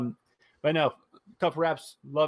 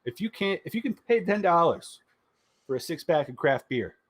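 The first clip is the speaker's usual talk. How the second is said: upbeat pitchman voice, higher, pushing; sponsor ad read; 5 semitones higher than usual. Acted. Host reading sponsor spot for listeners, hyped ad read. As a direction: sell it like an infomercial.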